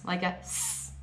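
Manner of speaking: "s" as a plain voiceless hiss; s sound. Shown as a demonstration. Teacher demonstrating the unvoiced "s". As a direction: breathe out a short "s". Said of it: "pronounced like an S".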